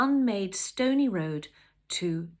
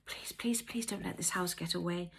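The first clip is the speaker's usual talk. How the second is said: soft, hushed pleading; beseeching; 5 dB quieter than usual. Acted. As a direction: beg softly, under your breath. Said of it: "she beseeched".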